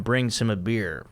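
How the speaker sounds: usual speaking voice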